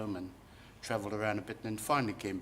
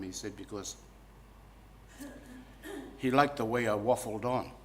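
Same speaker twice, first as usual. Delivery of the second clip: ordinary talk, nothing marked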